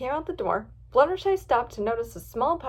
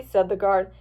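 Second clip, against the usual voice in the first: usual speaking voice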